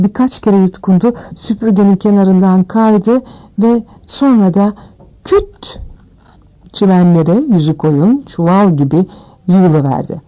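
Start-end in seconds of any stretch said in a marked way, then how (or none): 5.2-5.8 abrupt, high-pitched thud word; short sound-effect exclamation; imitated thud. A narrator's reading. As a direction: drop it like a sudden bump. Narrator imitates the thud of falling.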